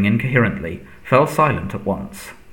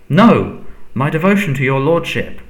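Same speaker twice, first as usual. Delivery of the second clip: higher, louder plea; flustered servant protesting loyalty; anxious protest; 6 semitones higher, 5 dB louder than usual. A narrator's reading. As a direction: burst in with a frightened denial. Servant protests, cut off by master.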